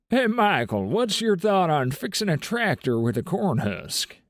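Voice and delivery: a hillbilly Southern twang calling out to Michael; hillbilly accent. Retold or imitated. Asking Michael about the tractor in a hillbilly accent. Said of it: "In a hillbilly accent".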